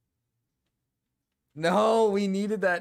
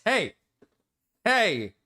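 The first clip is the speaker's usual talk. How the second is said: loud high call to get attention; yelling at friend; 5 semitones higher, 4 dB louder than usual. Real, not acted. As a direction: shout to cut him off. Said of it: "I had to yell at Marcus".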